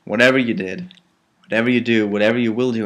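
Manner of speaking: ordinary talk, nothing marked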